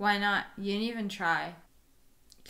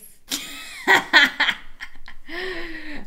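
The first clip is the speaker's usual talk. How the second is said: high, loud giggling fit; long real laugh; 8 semitones higher, 5 dB louder than usual. Real, not acted. Amused by a stranger's appearance, long laughing fit.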